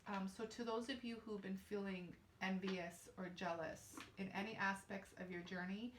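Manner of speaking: ordinary talk, nothing marked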